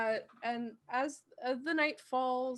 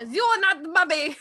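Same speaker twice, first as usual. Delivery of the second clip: mock scolding voice; 5 semitones higher, 9 dB louder, faster than usual. Joking reply in put-on voice.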